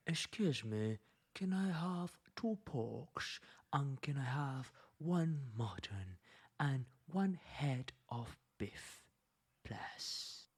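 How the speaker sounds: quiet, polite noble request; noble asking. Retold or imitated. Lecturer voicing nobles ordering meat, quiet polite noble. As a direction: softly ask like a nobleman. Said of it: "So they prefer to use".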